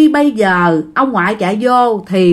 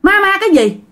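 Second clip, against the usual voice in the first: high-pitched, indignant retort; arguing back; 9 semitones higher than usual. Acted. Grandpa scoffing at grandma's talk of ghosts, arguing back. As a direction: throw it back, pitched well up. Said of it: "cái ông ngoại mới cãi".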